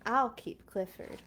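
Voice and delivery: ordinary delivery, nothing marked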